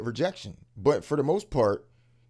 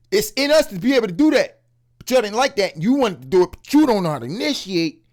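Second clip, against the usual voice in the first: passionate rant; 7 semitones higher, 6 dB louder than usual. Real, not acted.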